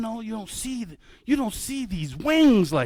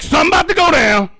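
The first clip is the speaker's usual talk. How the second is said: mock alarm; 4 semitones higher, 6 dB louder, faster than usual. Retelling alarm as talons lock.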